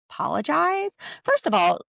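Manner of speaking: high, incredulous "Apologize?"; mock stunned question; shocked. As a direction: gasp the word out in disbelief. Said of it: "she is in shock".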